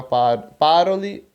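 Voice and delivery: "parole" stressed on PA; PA-ro-le, showing the mistaken stress; wrong first-syllable stress. Shown as a demonstration. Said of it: "e não".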